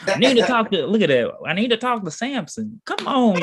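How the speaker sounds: high-pitched, playful exclaiming; worked-up, high comic plea; excited outburst. Pretend. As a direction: break off reading and cry out in mock urgency. Excited playful outburst over Samson.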